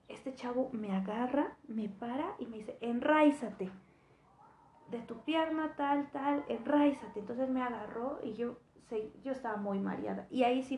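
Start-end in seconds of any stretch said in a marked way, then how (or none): 2.8-3.7 loud, firm order; firm command. Retold or imitated. The man giving her a firm command. 4.9-7.2 loud, firm order; firm command. Retold or imitated. The man giving her a firm command.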